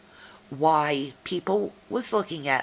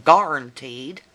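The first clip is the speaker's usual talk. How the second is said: "guaranteed" with a broad southern GAR-un-teed, louder; southern kinfolk pronunciation; 4 semitones lower, 7 dB louder than usual. Shown as a demonstration. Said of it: "as some of my kinfolk say".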